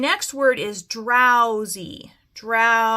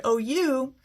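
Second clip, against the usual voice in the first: normal talking voice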